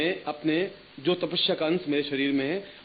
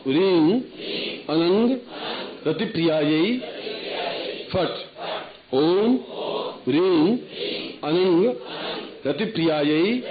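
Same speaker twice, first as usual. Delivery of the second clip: mantra for repetition; 3 semitones higher, much slower than usual. Shown as a demonstration. Guru leading disciples in repeating the mantra, slow chant. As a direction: intone each word for them to echo. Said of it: "अब आप मेरे साथ उच्चारण करें".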